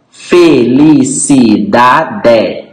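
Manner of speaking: "Felicidade" slowly split as fe-li-ci-da-de; syllable by syllable. Shown as a demonstration. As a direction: count out five separate syllables in "Felicidade". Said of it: "cinco sílabas". ("Felicidade" pausado, sílaba por sílaba)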